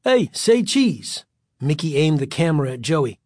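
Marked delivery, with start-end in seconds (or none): 0.0-1.2 slow, drawn-out taunt; sing-song mock; teasing. A narrator's reading. Bully teasing with camera.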